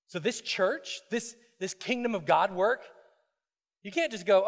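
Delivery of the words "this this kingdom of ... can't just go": ordinary talk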